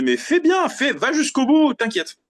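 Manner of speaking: retold high-pitched pep talk; urging reassurance. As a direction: tell them to go for it, voice lifted high and stretched. Retells urging the mixer on.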